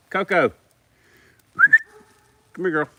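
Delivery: high-pitched dog call. Real, not acted. Owner calls his dog to come, calling out.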